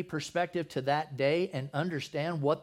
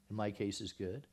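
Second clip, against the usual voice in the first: ordinary talk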